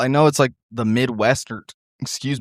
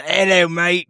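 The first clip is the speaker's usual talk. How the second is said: a mock British Hello, mate, lifted higher; chirpy fake British greeting that slides toward pirate; bad British accent; 6 semitones higher than usual. Shown as a demonstration. Friend showing her best British impression, sounding like a pirate. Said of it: "the best one I can do is".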